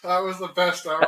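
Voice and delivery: loud, high, still half-laughing; delighted. Real, not acted. Telling his co-host about the video, loud and delighted. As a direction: gush about it, barely over the laugh.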